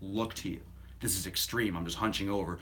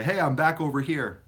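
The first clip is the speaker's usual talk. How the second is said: mock call-out; 7 semitones higher, 8 dB louder than usual. Quoting followers calling out.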